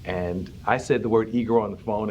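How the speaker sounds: normal talking voice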